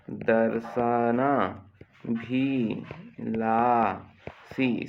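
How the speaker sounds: दर्शनाभिलाषी drawn out very slowly, syllable by syllable; slow dictation. Shown as a demonstration. Teacher dictating a spelling word to the class, very slow and drawn out. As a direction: stretch दर्शनाभिलाषी slowly so children can write it. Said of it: "श षटकोण का आएगा देखना".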